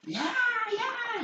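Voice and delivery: high-pitched mock baby wail; imitated baby crying. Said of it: "adentro lloraba un bebé". (llanto de bebé imitado, muy agudo)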